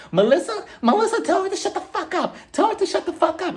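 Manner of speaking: high-pitched mock shrieking demand; upset. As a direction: imitate a worked-up woman, voice shooting up. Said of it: "Teresa starts getting upset".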